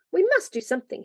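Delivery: plain reading voice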